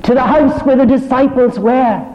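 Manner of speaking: high, loud, ringing proclamation; full-force preaching. Acted. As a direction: lift the whole room with the joy of it. Preacher proclaims Mary's message.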